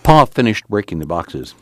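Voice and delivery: ordinary delivery, nothing marked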